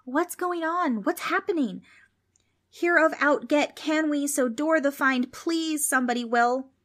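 put-on high, panicky kids' voices; nervous voices. A narrator's reading. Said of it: "Nervous voices echoed in the dark".

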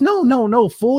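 imitating Les, loud and high, teasing; playful no. Les playfully refuses the hand-off.

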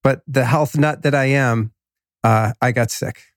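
usual speaking voice

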